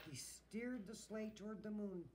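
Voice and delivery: plain reading voice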